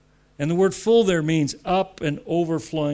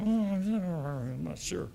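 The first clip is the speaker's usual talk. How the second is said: soft, slow, unsure musing; doubtful inner voice; 2 semitones higher, 6 dB quieter, much slower than usual. Retold or imitated. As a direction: let the doubt drift out quietly.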